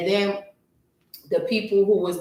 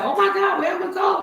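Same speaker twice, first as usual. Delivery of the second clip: high frantic shout; panicked shout; 13 semitones higher, faster than usual. Retold or imitated. Stuck with thirty days to move, a high frantic panicked shout. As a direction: yell it out in full-blown panic. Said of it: "I'm panicking".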